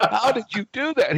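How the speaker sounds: high-pitched mock astonishment; incredulous. Pilot beaten at arcade game by colleague, incredulous.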